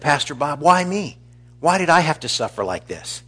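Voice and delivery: high, pleading pretend whine to the pastor; mock complaint. Pastor imagining the sick man asking him why, high mock plea.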